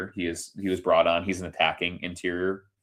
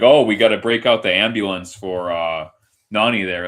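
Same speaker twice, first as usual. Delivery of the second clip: sarcastic mockery; 8 dB louder than usual. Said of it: "sarcastic person is like".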